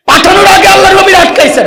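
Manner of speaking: high, loud excited cry with a laugh; excited shout. Real, not acted. Preacher exclaiming to the crowd, high and laughing.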